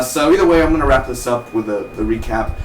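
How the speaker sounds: normal talking voice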